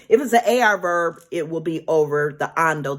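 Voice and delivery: ordinary delivery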